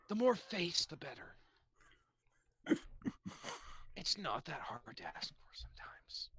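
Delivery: whispered grumble; an ogre's wistful whisper. Acted. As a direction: hush it like a sulky aside. Ogre muttering about eating faces.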